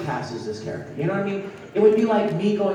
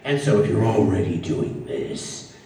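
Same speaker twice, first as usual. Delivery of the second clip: much lower, like a pitch-shifted recording; 9 semitones lower than usual. Shown as a demonstration. Showing how pitching the voice down makes it badass, much lower. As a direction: drop the voice way down into a badass growl. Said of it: "So it would go from here".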